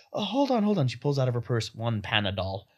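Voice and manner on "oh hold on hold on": high, sudden bright mock mother's voice; Balinese mom. Imitating the mom digging in her purse, high. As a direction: pop it up high like she just remembered. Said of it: "and then she's like".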